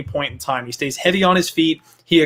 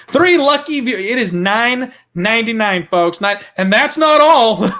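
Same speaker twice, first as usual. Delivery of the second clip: loud, high, hyped TV pitchman; infomercial; 5 semitones higher, 4 dB louder than usual. Pretend. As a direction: sell it like a late-night ad host. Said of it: "I sound like I'm running an infomercial".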